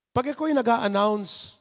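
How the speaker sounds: ordinary delivery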